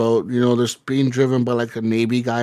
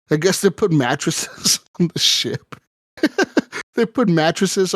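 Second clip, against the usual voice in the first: laughing; 4 semitones higher than usual. Real, not acted. Laughing at mattresses on ship.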